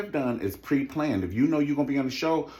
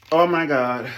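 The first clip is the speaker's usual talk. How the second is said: loud, exasperated exclaim; 3 semitones higher, 8 dB louder than usual. Real, not acted. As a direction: throw it out loudly.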